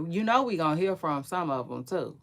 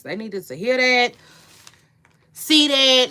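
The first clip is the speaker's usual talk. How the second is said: louder and higher, pressing the point home; emphatic; 5 semitones higher, 8 dB louder than usual. Real, not acted. Host insisting the jury needed to see that, emphatic. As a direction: insist on it, voice rising. Repeats the line, now loudly.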